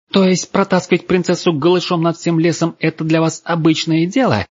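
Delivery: very high, indignant question; high-pitched girl, scandalised and accusing; outraged challenge. A narrator's reading. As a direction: challenge them in a high, furious voice.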